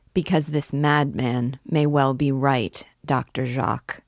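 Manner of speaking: plain reading voice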